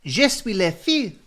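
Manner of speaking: put-on high, loud little-girl voice from an old advert; child in French advert. Host quoting Muzzy advert girl, high child voice. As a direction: chirp it like a kid in a language tape. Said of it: "two kids on the advert that went".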